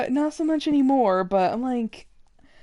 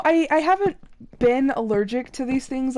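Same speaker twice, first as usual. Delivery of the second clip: ordinary talk, nothing marked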